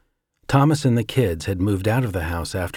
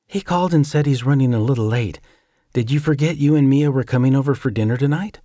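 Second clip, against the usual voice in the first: higher, light casual woman's voice; a lightly voiced cheerful wife; Janet's voice; 5 semitones higher, 3 dB louder than usual. Janet speaks to Jake.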